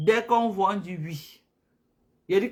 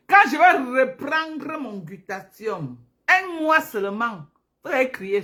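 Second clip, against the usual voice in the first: excited build; 7 semitones higher, 7 dB louder than usual. Real, not acted. Teasing followers about glutathion.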